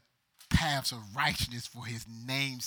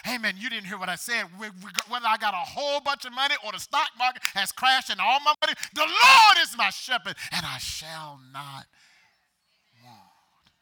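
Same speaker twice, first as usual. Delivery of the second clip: climactic preaching; 8 semitones higher, 13 dB louder than usual. Real, not acted. Pastor pressing the church to hear him, full force.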